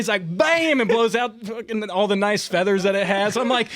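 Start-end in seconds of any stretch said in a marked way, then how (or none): 0.4-0.8 very high, loud shouted bam; imitated gunshot. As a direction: mimic the blast with full force. Retelling second gunshot.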